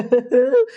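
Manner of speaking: short, high, leering laugh; creepy laugh. Acted. Creep gloats to the trapped girl, leering laugh.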